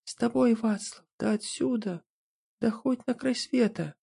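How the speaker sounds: put-on high, soft, devoted girl's voice; tender young woman. A narrator's reading. Princess answers her husband.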